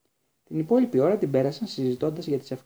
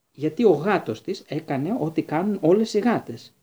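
stubborn; 4 semitones higher, 6 dB louder than usual. A narrator's reading. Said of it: "συνέχισε πεισματικά ο Χάγκριτ".

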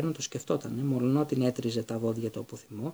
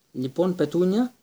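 uncle's voice; 5 semitones higher, 6 dB louder, slower than usual. A narrator's reading. Uncle voiced calling his wife.

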